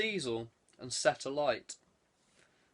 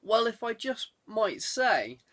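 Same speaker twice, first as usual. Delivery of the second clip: hesitant superintendent; 7 semitones higher than usual. Acted. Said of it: "began the super".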